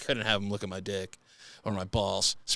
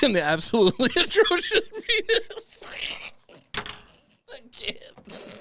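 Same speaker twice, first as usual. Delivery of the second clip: high words dissolving into laughter; helpless laughter; slower than usual. Real, not acted. Host repeating terrible widge, laughing helplessly. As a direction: give up mid-laugh, pitch climbing. Host loses it over both phrases.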